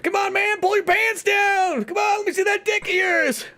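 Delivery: high, hammed-up party yell; drawn-out rowdy hollering, building as it goes; Ben Affleck. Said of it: "Ben Affleck yelling at people".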